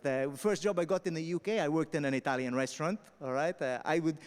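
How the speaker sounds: ordinary delivery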